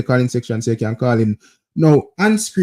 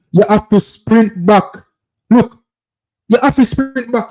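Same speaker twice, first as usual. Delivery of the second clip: rising insistence; 5 semitones higher, slower than usual. Real, not acted. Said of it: "coach repeating louder".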